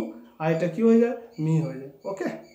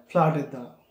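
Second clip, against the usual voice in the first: ordinary talk, nothing marked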